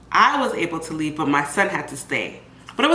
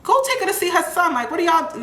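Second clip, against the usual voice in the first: scolding staff; 11 semitones higher than usual. Retold or imitated. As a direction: push the staff to take her to her son. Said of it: "They're like".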